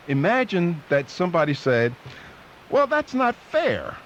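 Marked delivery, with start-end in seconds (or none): 2.7-4.0 high, drawn-out mock objection; mock protest. Somebody objecting to steak prices, mock protest. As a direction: object like an aggrieved bystander, hammed up.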